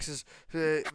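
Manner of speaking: usual speaking voice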